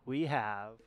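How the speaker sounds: infomercial voice. Pretend. Hater Shades mock infomercial.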